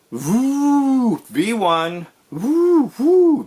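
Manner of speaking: hammed-up buzz bomb noise; mock rocket drone.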